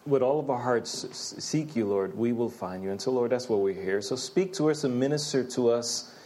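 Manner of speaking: hushed earnest prayer. Real, not acted. Closing prayer before the sermon.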